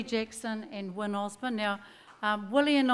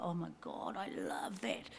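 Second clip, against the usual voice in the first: hushed whisper, much softer; awed whisper; 6 semitones lower, 13 dB quieter than usual. Retold or imitated. Singer hearing Ruya's chant in the studio, whispered awe. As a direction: breathe it out in awe. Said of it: "and I'm like".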